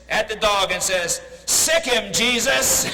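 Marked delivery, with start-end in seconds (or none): 1.5-2.9 higher and louder, a sharp command; the parrot orders the Doberman, loud and commanding; commanding the dog. Acted. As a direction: sic the dog on him, punchline delivered loud. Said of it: "the parrot looks down and says".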